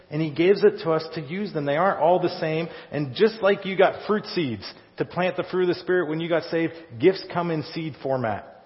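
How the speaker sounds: ordinary delivery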